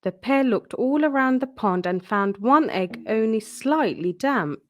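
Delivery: ordinary delivery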